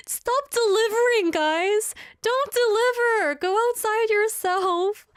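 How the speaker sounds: high, loud pleading at the robot; mock pleading. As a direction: beg the little robot to stop, voice way up. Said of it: "I was like".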